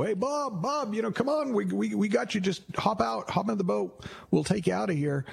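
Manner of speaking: high, sustained call from a rescue boat. Acted. Police calling Bob from a boat, calling out high.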